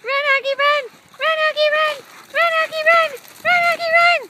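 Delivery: high, loud chant building up; yelling from the sidelines. Real, not acted. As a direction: cheer the dog harder each time. Cheering dog race.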